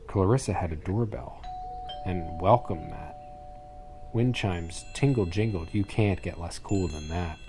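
usual speaking voice

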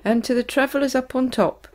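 ordinary delivery, nothing marked

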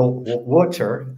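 water drawn out as wooder, Philly style; Philly accent. Shown as a demonstration. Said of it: "hear my Philly accent with the water".

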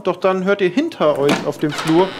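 ordinary talk

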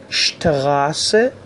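شتراسه opening on "sht". Shown as a demonstration. Teacher modelling the German street word, شتراسه opening on sht. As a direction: say شتراسه with sh before the t.

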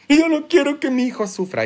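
high, quick mock protest; hurried high-pitched parental whine; mimicked overprotective parent. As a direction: imitate a fretting parent in a put-on high voice.

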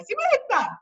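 put-on Simonetta called out high, like a mother calling her child; mother calling her little girl Simonetta. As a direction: call Simonetta the way a mom calls a kid home. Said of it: "it reminds me of my mother when I was a child".

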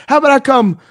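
high, loud mock yell of a threat; mock threatening shout. Quoting the caller's threat, high mock yell. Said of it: "he was like".

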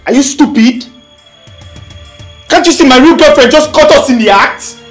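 high, loud, outraged questions; furious shouting. Acted. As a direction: yell at her in outrage. Furious quarrel after being caught.

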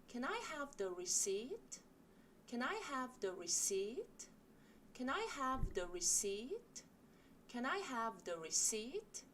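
very slow, clearly spaced teaching read; slow model sentence. Shown as a demonstration. Teacher models the request slowly for the class. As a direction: say it slowly for students to repeat. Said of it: "같이 이 문장 연습해 보실게요".

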